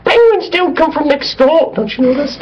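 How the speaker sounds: very high shrill British Python lady voice; Monty Python falsetto. Retold or imitated. Doing Monty Python for his British co-host, very high British falsetto. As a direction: squeak it like a Monty Python housewife. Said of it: "It's Monty Python".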